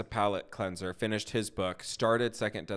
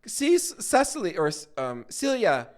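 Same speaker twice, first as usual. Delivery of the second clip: much higher, louder, playful teasing voice; teasing contestants; 13 semitones higher, 4 dB louder than usual. Retold or imitated. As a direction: rib her like giddy teammates. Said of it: "they're like".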